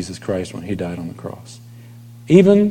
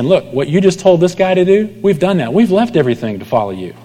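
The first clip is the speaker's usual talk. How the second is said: mock Peter; 7 semitones higher than usual. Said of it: "Peter is basically saying".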